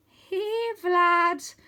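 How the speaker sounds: high, nervous coaxing; boy nervously calling a dragon; wobbling voice. Acted. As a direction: coax the dragon with a shaky voice. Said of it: "my voice wobbling".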